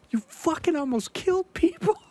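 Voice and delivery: high, near-crying accusation; upset outburst. Retold or imitated. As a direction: voice cracking with outrage. Furious at band after show.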